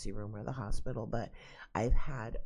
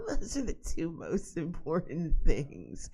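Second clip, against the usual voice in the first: amused, higher and louder through laughter; laughing; 5 semitones higher, 5 dB louder than usual. Real, not acted. Joking about toilet paper and food, laughing.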